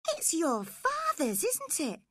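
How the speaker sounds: put-on high girlish voice, pleading; a young girl defending herself, pitched high; spoilt young girl. Girl pleading with her nurse, high girlish voice.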